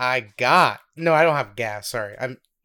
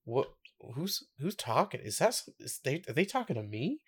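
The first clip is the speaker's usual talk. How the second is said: imitated confusion; 2 semitones higher, 10 dB quieter than usual. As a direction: act puzzled, half to yourself. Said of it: "he's now completely confused".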